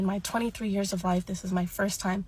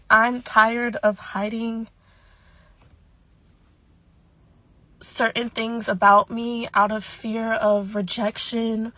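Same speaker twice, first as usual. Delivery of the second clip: slow, louder, voice straining with feeling; emotional; 6 dB louder than usual. Real, not acted. Creator opening up to TikTok about hiding her hand, emotional. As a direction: push through the words while getting emotional. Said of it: "bear with me if I get a little emotional".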